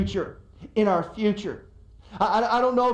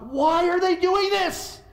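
mock freak-out; 8 semitones higher than usual. Head buried in his wife, a higher panicky whine. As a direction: whine it into your wife's side. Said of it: "put my head into ... and go".

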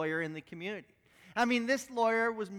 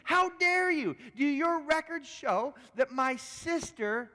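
angry lawyer; 4 semitones higher than usual. Retold or imitated. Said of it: "He said".

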